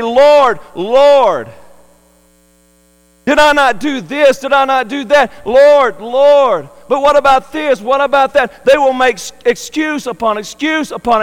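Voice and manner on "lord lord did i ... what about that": raised, loud, desperate calls of "Lord, Lord"; pleading excuses. Acted. People at judgment plead with the Lord, desperate raised calls. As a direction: beg the Lord with one excuse after another.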